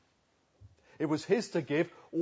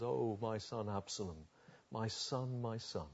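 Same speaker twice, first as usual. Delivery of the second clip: soft lament, much softer; mild grief; 3 semitones lower, 11 dB quieter than usual. Acted. David mourning his son Absalom, soft lament. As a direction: let it fall away in mourning.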